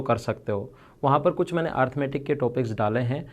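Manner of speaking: promo plug. Acted. Teacher plugs his Unacademy app to students, upbeat ad read. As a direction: pitch the app like a quick ad.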